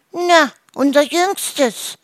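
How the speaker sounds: very high, drawn-out goose wail; slow, loud, tearful whine; whiny, tearful. A narrator's reading. Goose explains the missing youngest, whining tearfully. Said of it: "erklärte Gisela weinerlich".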